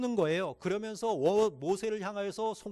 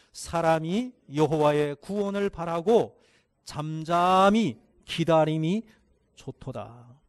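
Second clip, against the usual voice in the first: unison reading; 8 dB louder, slower than usual. Real, not acted. Congregation reads Lamentations verse.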